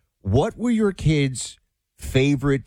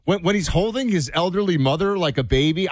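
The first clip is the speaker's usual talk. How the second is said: usual speaking voice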